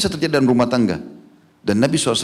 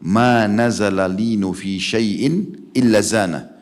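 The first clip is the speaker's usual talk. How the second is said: hadith recitation; much slower than usual. Retold or imitated.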